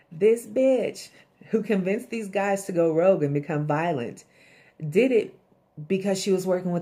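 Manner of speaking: loud, high, outraged rant; furious at the betrayal; indignant outburst. Real, not acted. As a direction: spit it out in real disbelief. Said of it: "indignant outburst at Connie".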